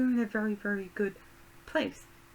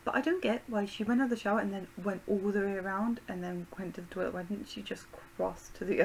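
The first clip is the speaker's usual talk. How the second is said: ordinary delivery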